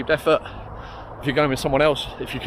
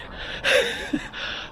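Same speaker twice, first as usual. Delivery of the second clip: airy winded chuckle; breathless laugh; 5 dB quieter than usual. Real, not acted. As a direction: laugh through heavy breathing. Breathless laugh while running.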